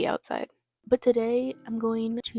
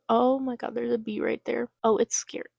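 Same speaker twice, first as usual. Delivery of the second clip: ordinary talk, nothing marked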